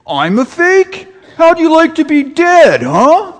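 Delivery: angry Brooklyn Santa. Retold or imitated. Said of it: "a Santa with kind of a Brooklyn accent".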